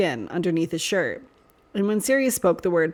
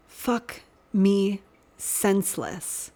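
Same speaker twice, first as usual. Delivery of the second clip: every word slow and crisp: Fuck me senseless; enunciated clearly; much slower than usual. A narrator's reading. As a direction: spell out Fuck me senseless slowly, each word distinct. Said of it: "he enunciated clearly".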